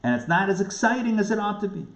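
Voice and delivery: ordinary delivery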